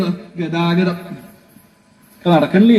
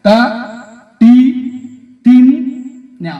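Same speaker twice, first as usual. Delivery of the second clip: drum syllables; 3 semitones higher, 4 dB louder, much slower than usual. Shown as a demonstration. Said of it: "ആദ്യം കൂട്ടുക".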